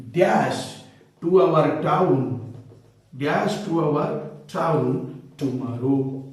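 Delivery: slow, deliberate example read; slow dictation. Shown as a demonstration. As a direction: spell the example out slowly for students. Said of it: "suppose if I say".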